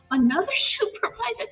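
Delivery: high, incredulous question with a laugh; retold disbelief. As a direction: squeak it out in amused dismay. Retells disbelief at further escalation.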